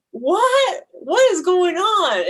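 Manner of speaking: high, loud cry of disbelief; shocked confusion. Retold or imitated. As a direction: blurt it out, baffled and distressed. Retold panic while crying nonstop.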